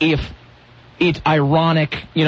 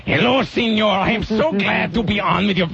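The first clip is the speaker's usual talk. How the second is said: mild mock Mexican accent; lightly put-on Mexican accent as the pepper puppet; Frito Bandito accent; 3 semitones higher than usual. Ventriloquist's pepper greeting hosts, mild mock Mexican accent. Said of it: "the guy going".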